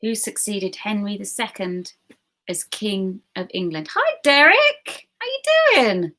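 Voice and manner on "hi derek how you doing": high, drawn-out mock greeting; playful greeting. Quiz host greeting Derek, playful high-pitched drawl. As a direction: sing out the hello, pitched way up and loud.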